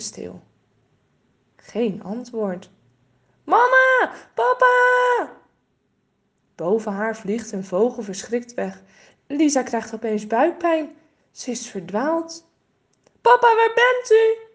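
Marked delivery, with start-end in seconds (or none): 3.4-5.3 high, loud desperate cry; calling out. A narrator's reading. Girl lost in the woods calling her parents, loud high cry. 13.2-14.4 high, loud worried call. A narrator's reading. Lost girl searching for her father, loud high call.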